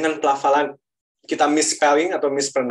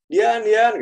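calling out; 9 semitones higher, 4 dB louder than usual. Retold or imitated. Mother calling out.

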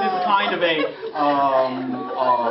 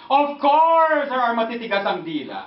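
regional Filipino accent; 4 semitones higher than usual. Shown as a demonstration. Showing dialects of the hard-tongued, regional Filipino accent. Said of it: "because of the original accents".

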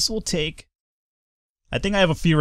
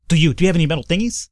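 fast, higher jittery tweaker; twitchy slurred sniffing addict; 4 semitones higher, faster than usual. Acted. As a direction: ask eagerly like a twitchy addict. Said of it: "my tweaker voice".

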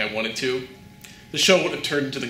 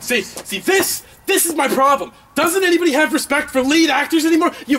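high-pitched outraged complaint; indignant rant; 11 semitones higher, 3 dB louder than usual. Acted. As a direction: build into a shrieking diva rant. Star rants at crewmate.

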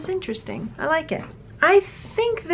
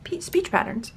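ordinary talk, nothing marked

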